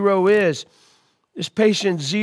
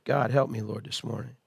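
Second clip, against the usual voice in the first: fading to a much lower, softer murmur; hushed prayer; 10 semitones lower, 11 dB quieter, faster than usual. Acted. Pastor asking God for help this morning, hushed prayer. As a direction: drop into a quiet plea.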